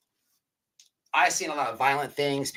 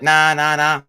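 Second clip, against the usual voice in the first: loud refusal; 3 semitones higher, 11 dB louder, slower than usual. Retold or imitated. Group loudly refuses the beggar.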